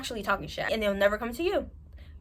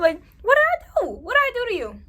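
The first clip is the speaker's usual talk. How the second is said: exasperated question; 9 semitones higher, 8 dB louder than usual. Retold or imitated. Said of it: "ignore my accent from New York".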